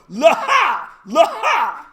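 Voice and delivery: loud, high mock-French horse call. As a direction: shout to the horses in playful French. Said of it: "Oh, except in French".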